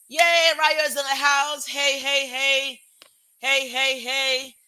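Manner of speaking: copied hype greeting, chanted heys; Cobbs Den. Retold or imitated. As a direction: cheer a new arrival in like a hype host. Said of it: "I'm copying Cobbs Den and, and Blue Jeans to welcome everybody".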